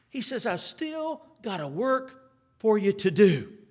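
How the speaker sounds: loud, high, ringing sermon voice; full-force revival preaching. Acted. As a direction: preach the story out to the whole church. Preaching the Lord's charge.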